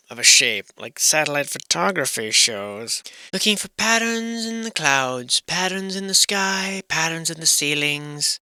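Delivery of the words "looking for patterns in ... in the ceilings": a hint of a dreamy narrator; mock mysterious. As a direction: drift into a half-joking spooky documentary tone. Musing on patterns, voice put on.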